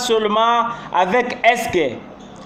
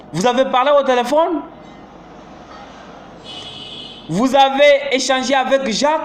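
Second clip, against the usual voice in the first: higher pitch, rising at the end; rising questions; 4 semitones higher than usual. Shown as a demonstration. Teacher illustrating rising yes-no questions. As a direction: ask brightly, ending up. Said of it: "C'est l'intonation montante qui marque".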